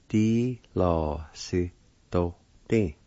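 "Di lo si do ti" slowly, each syllable drawn out. Shown as a demonstration. Teacher pronouncing the word platform, "Di lo si do ti" slowly in syllables. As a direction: say "Di lo si do ti" slowly with gaps. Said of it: "is pronounced like this".

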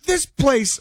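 mock-amazed tourist quote; gushing tourist. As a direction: gush like a wide-eyed visitor. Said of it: "they don't sound like this".

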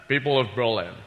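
a hint of Connery's burr on a speech; Sean Connery as Kennedy. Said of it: "Sean Connery doing, uh, President Kennedy".

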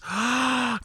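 high gasp of mock delight; playful swoon gasp. Real, not acted. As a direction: gasp like a smitten fan. Swooning at trailer skills.

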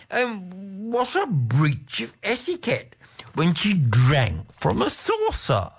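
a shocked aristocrat objecting; protesting Marquis. A narrator's reading. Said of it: "protested the Marquis de Casaubon, shuddering".

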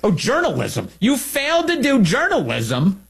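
high, drawn-out sarcastic jab; sarcastic scorn. Real, not acted. Host jeering at the reporter for skipping journalism, sarcastic. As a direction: land the insult slowly, dripping sarcasm.

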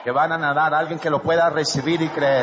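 loud preacher's proclamation; proclamación en voz alta. Real, not acted. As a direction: proclaim it loudly to the congregation. (predicador proclamando a viva voz)

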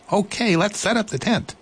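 high, bright little mouse voice; eager little mouse. Acted.

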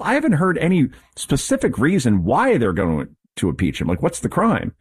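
ordinary talk, nothing marked

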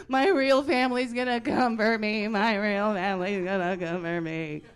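loud, hammed-up child's wail; freaking out. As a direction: imitate a sister's panicked meltdown at full volume. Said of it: "She started freaking out".

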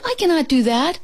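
high, agitated protest; shouted refusal. A narrator's reading. Toad tells Frog he cannot run, shouting. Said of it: "shouted Toad".

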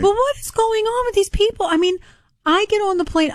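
high, exasperated outburst. Real, not acted. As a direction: throw your hands up in disbelief. Exasperated rant about airline passengers.

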